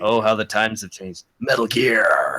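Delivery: rough whispered soldier impression; throaty, whispered action-hero voice; Solid Snake. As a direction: whisper it hoarsely like a game hero. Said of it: "doing the Solid Snake voice".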